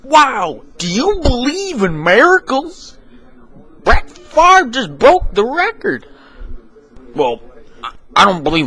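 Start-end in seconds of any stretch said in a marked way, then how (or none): 0.0-6.1 a high, slow sportscaster; high slow announcer. Acted. Announcer opening the Thanksgiving broadcast, high and slow. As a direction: announce the matchup high and drawn out.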